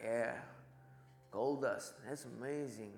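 soft, understated and spaced out; deadpan reply, trailing away quietly; imitating an unfazed preacher. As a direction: murmur it as if miracles are routine. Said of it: "And he goes".

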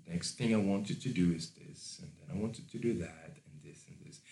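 quiet, unhurried control; hushed, relaxed command fading to almost nothing; soft and slow. Shown as a demonstration. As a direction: give instructions softly, with easy pauses. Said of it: "speak softly and slowly".

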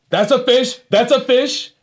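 high, loud, excited yell at the catch; excited shout. Retold or imitated. Little brother yelling as the fish bites, excited. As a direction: shout it out like you've hooked a big one. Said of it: "as he said".

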